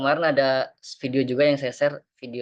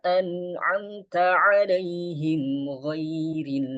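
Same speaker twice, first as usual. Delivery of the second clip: slow, higher chanted tilawah; Quran recitation; 4 semitones higher, much slower than usual. Shown as a demonstration. Teacher recites the Fatihah verse for the class. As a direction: recite slowly and calmly.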